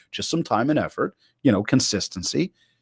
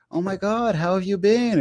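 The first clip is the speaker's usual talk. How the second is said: mock gushing; 8 semitones higher, 4 dB quieter than usual. Mocking stale catch-up greeting.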